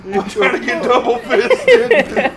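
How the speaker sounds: slow, laughing taunt. Pretend. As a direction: drawl it while laughing. Mock bar confrontation.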